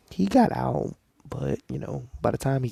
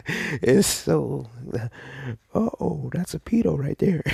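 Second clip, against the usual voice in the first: higher, louder, laughing while talking; laughing through pain; 4 semitones higher, 5 dB louder than usual. Real, not acted. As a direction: talk through laughter that hurts. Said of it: "it hurts to laugh".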